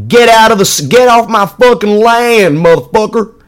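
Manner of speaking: hammed-up Southern landowner shouting people off his land. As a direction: scream it like a shotgun-toting swamp landowner. Mock Southerner chasing off outsiders.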